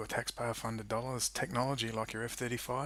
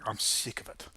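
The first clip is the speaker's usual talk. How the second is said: ordinary delivery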